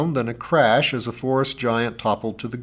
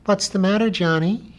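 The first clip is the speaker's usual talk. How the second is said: higher, small piping voice; piping beast; 9 semitones higher than usual. A narrator's reading. Lummox asking Johnny what is wrong, high piping. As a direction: pipe it up like an anxious pet. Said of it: "he piped".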